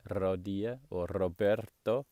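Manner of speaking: RODILLA and ROBERTO with long trilled Rs, slowly; rolled R. Shown as a demonstration. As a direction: roll the R hard in Rodilla and Roberto. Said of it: "Lucky I can do that".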